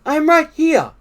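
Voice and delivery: high, loud reply; Frog's voice. A narrator's reading. Frog answers Toad from beside the bed, high voice.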